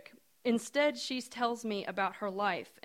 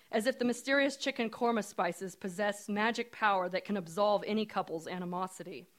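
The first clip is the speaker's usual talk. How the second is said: ordinary delivery, nothing marked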